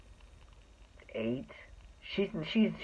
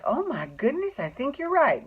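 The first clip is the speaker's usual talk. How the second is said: mock surprise; 9 semitones higher than usual. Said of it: "So they're like".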